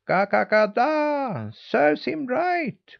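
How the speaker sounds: high, slow, drawn-out cackle; crying hens. A narrator's reading. Hens crying out at the punished boy, high drawn-out cackling. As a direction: cackle it out like a gloating hen. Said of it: "cried the hens".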